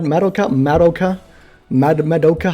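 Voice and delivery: MADOKA with a short "mad" as first syllable; people's wrong pronunciation. Shown as a demonstration. Streamer hunts for how people mispronounce it, bumpy. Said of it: "How do people sometimes pronounce".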